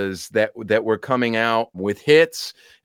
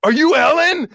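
higher, louder, eager fan's question; starstruck question; 13 semitones higher, 5 dB louder than usual. Retold or imitated. A fan asking the actress if it is her, high and eager. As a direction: blurt it out like a thrilled fan. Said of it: "and I go".

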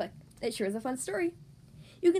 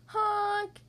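goose honk; 7 semitones higher than usual. Pretend. Goose speaks in story.

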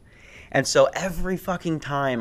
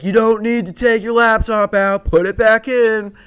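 TSA guard; 9 semitones higher, 6 dB louder than usual. Retold or imitated. TSA guard to passenger over a laptop, loud official order. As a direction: imitate a guard calling out impatiently. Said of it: "the guy was like".

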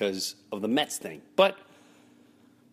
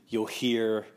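usual speaking voice

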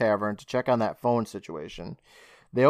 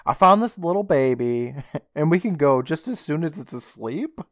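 weird voice; 4 semitones higher, 5 dB louder than usual. Retold or imitated. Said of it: "Louise says in a weird way".

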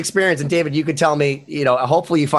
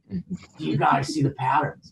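spaced-out buddy; 7 dB quieter than usual. Retold or imitated. Buddy voiced, staring at wall.

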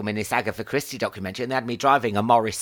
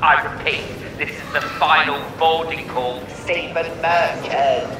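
formal airport tannoy call; airport announcement; 3 semitones higher than usual. Acted. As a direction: call it over the terminal speakers. Mock final boarding call.

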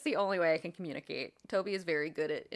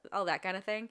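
ordinary delivery

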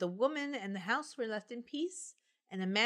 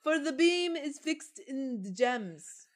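slow, high hammed-up door voice; talking door; 6 semitones higher, 3 dB louder than usual. Door explaining itself to witches, slow high character voice. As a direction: draw it out as an enchanted door. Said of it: "said the door".

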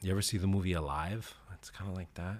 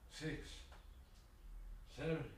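straining through reps; 7 semitones higher, 15 dB quieter, slower than usual. Real, not acted. Counting reps mid push-ups.

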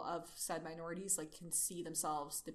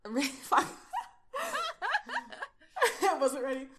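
laughing; 7 semitones higher, 9 dB louder than usual. Acted. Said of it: "he rasped".

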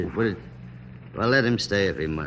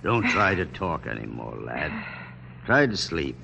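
lower, softer, tender hush; gentle comfort; 8 semitones lower, 5 dB quieter than usual. Acted. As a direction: soothe the dying lad to rest. General comforting dying boy.

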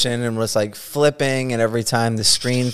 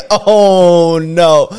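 dismayed; 6 semitones higher, 8 dB louder than usual. Retold or imitated. Seeing the man right there.

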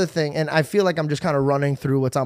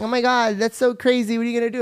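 higher, impressed mock gasp; gushing friends; 7 semitones higher than usual. Friends react to the [name] message, mock gushing. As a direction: play the starstruck friends, pitch up. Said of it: "They're like".

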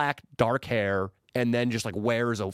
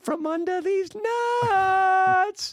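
very high, drawn-out squeal; punchline; 4 dB louder, much slower than usual. Retold or imitated. Cameraman delivers the prank punchline, slow very high squeal. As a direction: stretch the punchline slowly up high. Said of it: "Nico goes".

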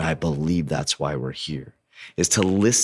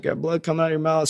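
worried mom; 10 semitones higher, faster than usual. Retold or imitated. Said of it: "She's like".

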